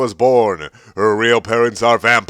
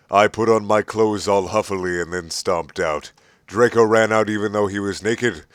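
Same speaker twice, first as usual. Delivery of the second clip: usual speaking voice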